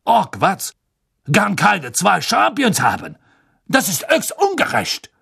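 high indignant French protest; indignant Frenchwoman. Acted. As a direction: protest in outrage, French accent. Maxime protesting.

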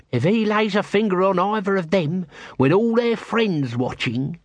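wary watchman. Acted. Nobby planning escape.

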